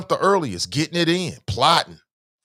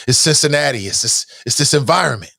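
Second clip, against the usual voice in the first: ordinary talk, nothing marked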